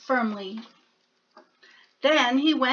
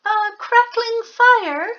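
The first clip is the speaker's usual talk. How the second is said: high, tentative mouse question; hopeful little mouse; 10 semitones higher, 7 dB louder than usual. Acted. Mouse cheekily asking Bear for a fire, high. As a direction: venture it hopefully. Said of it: "ventured the mouse".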